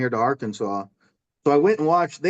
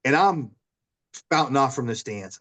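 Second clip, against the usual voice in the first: ordinary talk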